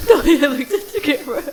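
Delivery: fast, loud laughing words. Real, not acted. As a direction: laugh through it. Laughing excitedly at friend.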